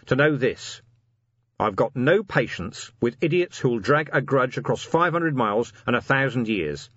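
rally them, plain-spoken and insistent; commander's rallying speech. A narrator's reading. Commander addresses dwarf and troll officers before a bad night, rallying.

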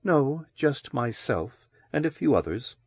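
breathy whispered reply; husky whisper. A narrator's reading. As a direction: whisper it with a smile. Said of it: "Loris smiled".

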